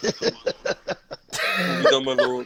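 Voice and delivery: laughing clone reply. Pretend. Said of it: "that was a good laugh".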